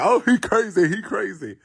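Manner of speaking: imitated reaction. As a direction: mimic his dismissive exclamation. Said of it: "he gonna be like".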